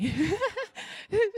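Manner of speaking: high-pitched laugh; laughing at the shared scripture; high burst of laughter. Real, not acted. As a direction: laugh at the coincidence. Laughs at coincidence.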